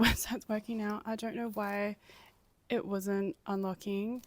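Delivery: ordinary talk, nothing marked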